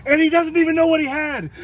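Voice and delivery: high, amused radio call from the guide. Retold or imitated. Guide teasing the finder over the radio, high and amused. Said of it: "he gets back on the radio and he goes".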